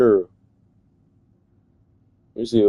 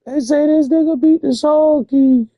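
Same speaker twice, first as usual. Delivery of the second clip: way higher, louder, leaning hard on beat; incredulous squeal; 3 dB louder than usual. Real, not acted. Repeating the claim to viewers, high disbelieving shriek. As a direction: shriek it like it's absurd.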